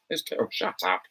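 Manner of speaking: ordinary delivery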